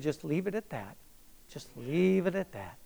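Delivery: soft, restrained counsel; gentle inner prompting. Retold or imitated. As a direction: quietly advise letting it go. Voices the Lord's quiet prompting.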